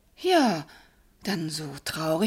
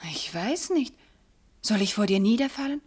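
ordinary talk

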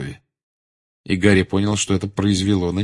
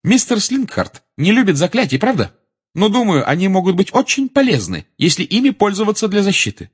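high, louder pupil arguing; ringing voice; 12 semitones higher, 5 dB louder than usual. A narrator's reading. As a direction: argue so the whole class can hear. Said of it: "а говорила звонким голосом". (звонкий, высокий, уверенный голос)

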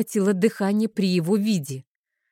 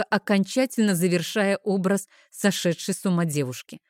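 usual speaking voice

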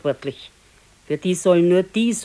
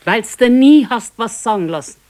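sharp, higher and louder reproach; scolding; 6 semitones higher, 8 dB louder than usual. Acted. Wife scolding her husband about cold beer, raised voice. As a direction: snap back at him, fed up.

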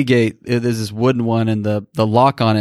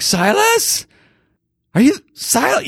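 calling out. Retold or imitated. Father searching calls son's name.

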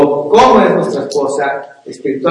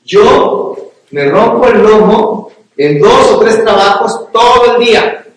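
mock proud protest, louder and higher; self-justifying husband; 5 semitones higher, 7 dB louder, slower than usual. Husband excusing himself from childcare, mock indignant. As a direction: boast about your hard work. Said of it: "y decir".

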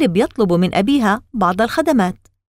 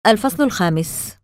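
ordinary delivery, nothing marked

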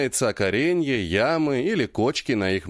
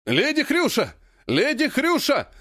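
calling out; 13 semitones higher than usual. A narrator's reading. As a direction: shout her name from afar. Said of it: "послышался от порога крик Патрика". (громкий высокий оклик)

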